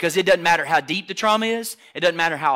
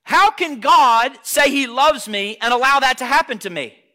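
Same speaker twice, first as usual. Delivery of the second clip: loud, high-pitched cry of protest; anguished questioning; 8 semitones higher, 9 dB louder than usual. Acted. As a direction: demand it like someone hurt by God. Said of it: "this is what people say".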